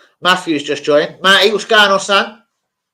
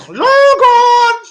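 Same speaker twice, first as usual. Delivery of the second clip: put-on very high, loud shout of a mum calling her kid; 9 dB louder than usual. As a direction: yell up the stairs like a mum calling for dinner. Mum calling Logan again.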